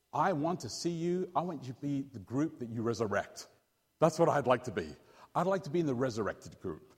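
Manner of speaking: normal talking voice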